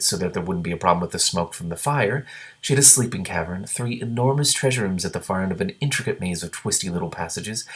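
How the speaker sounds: plain reading voice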